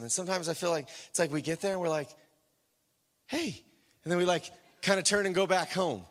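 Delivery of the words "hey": small high-pitched "hey"; acted-out timid little hello; awkward greeting. Awkward greeting, then going back home.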